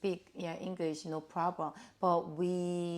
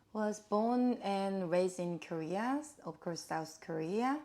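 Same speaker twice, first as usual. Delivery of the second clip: ordinary talk, nothing marked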